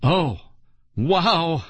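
mild, high shriek of surprise. A narrator's reading. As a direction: exclaim in astonishment, pitch up. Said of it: "I shrieked".

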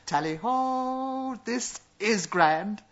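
drawn-out high hunting call; excited call. A narrator's reading. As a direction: sing out slow and high. Simon delighted, repeating his call.

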